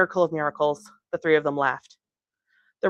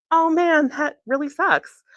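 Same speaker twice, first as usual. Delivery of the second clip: sympathetic; 10 semitones higher, 4 dB louder, slower than usual. Acted. Edie sympathetic, excited.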